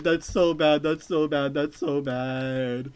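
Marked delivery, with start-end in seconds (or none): 1.5-2.9 very slow, stretched groan; slow, drawn-out. Real, not acted. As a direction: stretch every word out in disgust. Repeated reactions.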